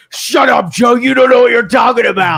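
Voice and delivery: heated put-down, hammed up; mock trash talk. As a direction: imitate a friend shooting you down. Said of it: "you talk shit".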